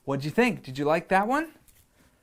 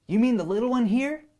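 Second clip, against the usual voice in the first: put-on eager boy voice; 7 semitones higher, 4 dB louder, faster than usual. Boy answers the lion.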